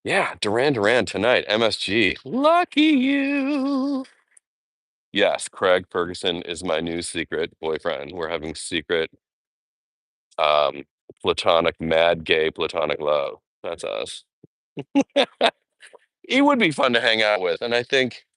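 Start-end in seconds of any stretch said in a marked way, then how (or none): 2.2-4.0 a mock-jealous singsong; high, slow teasing envy. Real, not acted. Vlogger teasing a fan about Duran Duran, high and drawn out. 14.8-15.5 short high giggle; a quick high laugh. Real, not acted. Vlogger giggling at her own joke, high.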